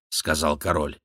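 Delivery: usual speaking voice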